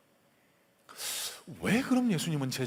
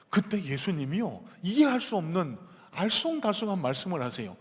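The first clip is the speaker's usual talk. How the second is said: ordinary delivery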